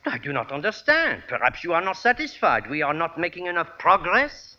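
puzzled, put-on French accent; phony French accent. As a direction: question him politely in fake French accent. Phony French professor puzzled at dismissal.

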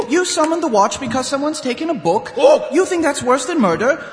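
indignant. Acted. Constable scolding the librarian, indignant and loud. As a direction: tell him off for wasting the watch's time.

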